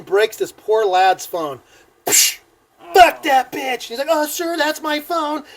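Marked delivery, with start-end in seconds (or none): 2.1-3.8 loud, high angry outburst; drunken rage. Retold or imitated. Drunk man after breaking the phone, furious shout. 4.1-5.4 high, slow plaintive objection; timid protest. Retold or imitated. Phone owner watching his phone get broken, high plaintive protest.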